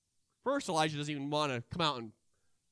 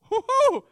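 nervous whoo-hoo; 13 dB louder than usual. Acted. Nervous about telling someone about Jesus.

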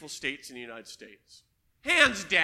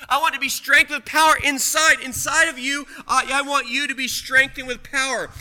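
urgent pulpit cry; fervent preaching; 3 semitones higher, 8 dB louder than usual. Acted. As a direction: press the plea for power. Preaching loudly on power.

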